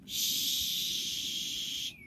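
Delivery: a soft whispered shush; breathy shush to make them listen; hushing shh. A narrator's reading. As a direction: hush the listener gently. Narrator hushes to listen.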